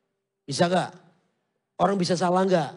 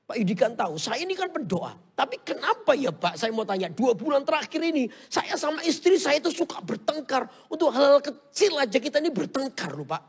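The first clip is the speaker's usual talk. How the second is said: complaining churchgoer; 8 semitones higher than usual. Retold or imitated. Church member complaining to pastor.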